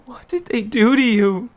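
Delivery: put-on high, loud boy's voice, pleading; the son stricken over his father; distraught boy. Boy holding his battered father's head, high and distraught.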